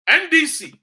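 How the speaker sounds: N D C shouted out, high and loud, with scorn; loud mocking call. Real, not acted. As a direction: bark the party name high to mock them. Mocking rival party name.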